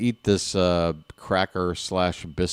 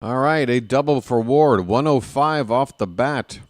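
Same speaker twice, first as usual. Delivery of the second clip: play-by-play; 3 semitones higher than usual. Acted. Live play-by-play call.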